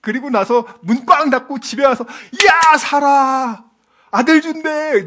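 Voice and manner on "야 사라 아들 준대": slow, loud, drawn-out call of joy; excited shout. Retold or imitated. Husband shouting to Sarah about a son, loud and slow. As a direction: bellow the good news across the house.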